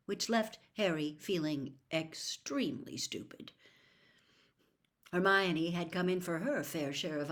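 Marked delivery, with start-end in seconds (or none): none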